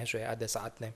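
hushed, dropped-down "ada saatnya"; quiet afterthought echo; soft echo. Acted. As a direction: let "ada saatnya" fall to a near hush. Preacher echoes key phrase softly.

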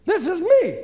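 high, loud jeering; taunting the devil. Pretend. Preacher taunting the devil with scripture. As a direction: shove the verse in his face.

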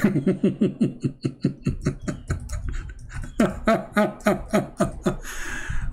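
breathy giggling laugh after a slip; helpless stifled laugh; real laughter. Real, not acted. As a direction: laugh it off, embarrassed. Breaks up after fumbling a word.

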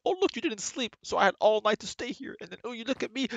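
high, squeaky mocking monster voice; mock Enderman taunt. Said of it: "they're like".

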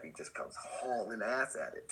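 ordinary delivery

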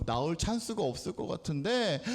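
normal talking voice

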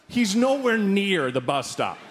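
ordinary talk

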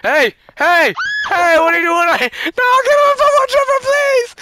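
high, loud shouts climbing into shrieks; panicked pleading. Pretend. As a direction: beg frantically from afar. Child nears wood chipper.

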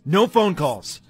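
sudden loud, high-pitched yell; alarmed outburst at full volume. Acted. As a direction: shout to stop someone at once. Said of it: "I didn't mean to yell".

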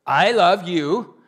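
loud, laughing mock boast of being the most faithful; boastful Peter. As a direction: lampoon a proud disciple bragging with a laugh. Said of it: "Which is saying what?".

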